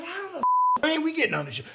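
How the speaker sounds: high, exasperated question; mock outrage at missing out; high-pitched retold exasperation; incredulous. Retelling his own outrage.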